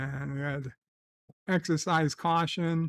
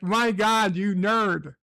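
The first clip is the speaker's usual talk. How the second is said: mocking rant; 4 semitones higher than usual. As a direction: tear into him like a scornful forum crowd. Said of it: "They're like".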